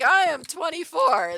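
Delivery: high, loud mock tantrum; outraged protest. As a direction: insist on it at the top of your voice. Quoting own outraged protest.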